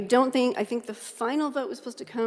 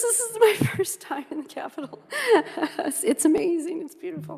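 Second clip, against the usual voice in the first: giggling through the words, much higher; giddy laughing awe at her first time in the Capitol; nervous laughter; 7 semitones higher than usual. Real, not acted. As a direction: laugh it out, overwhelmed and delighted.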